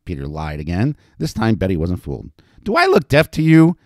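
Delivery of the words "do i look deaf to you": loud, high-pitched telling-off; scolding. A narrator's reading. Madam catching the newcomer's lie, scolding. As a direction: scold him for the fib. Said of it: "Betty scolded".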